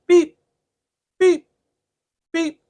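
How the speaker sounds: high imitated monitor beeps; imitating a hospital heart monitor; monitor beeping. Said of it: "he goes to me".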